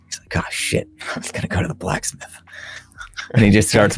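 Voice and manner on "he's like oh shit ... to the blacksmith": whispered offhand aside; hushed, sheepish whisper; mock whisper. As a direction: breathe it out like a guilty afterthought. Wizard whispers after destroying town.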